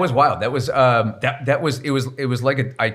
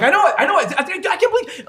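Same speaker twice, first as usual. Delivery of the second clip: excited fan reply; 10 semitones higher than usual. Retold or imitated. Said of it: "And I'm like".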